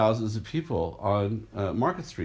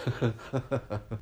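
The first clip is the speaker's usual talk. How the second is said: real laugh; 5 dB quieter than usual. Real, not acted. Laughing at own story.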